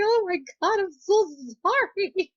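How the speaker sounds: retold frantic apology; high apologetic squeal. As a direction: beg forgiveness in a squeak.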